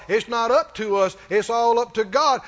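normal talking voice

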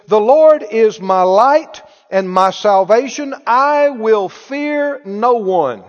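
slow, emphatic Bible declaration; preacher's ringing scripture reading; proclaimed scripture. Real, not acted. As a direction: read it out boldly, stretching the words.

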